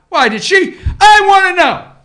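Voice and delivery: high, shouted "I wanna know"; loud demand. Real, not acted. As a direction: demand it at the top of your voice. Mock demanding question.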